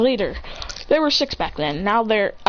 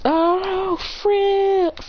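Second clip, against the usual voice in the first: dismayed realization; 9 semitones higher than usual. A narrator's reading. Student realizes teacher is calling.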